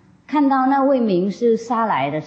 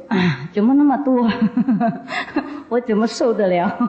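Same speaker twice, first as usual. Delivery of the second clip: laughing dismay; 2 semitones higher than usual. Retold or imitated. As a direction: laugh through the complaint. Chieftain balks at five precepts. (笑着抱怨戒律太多)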